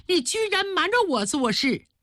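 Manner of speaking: high-pitched indignation without extra volume; outraged accusation. Acted. Queen discovers servant's deceit.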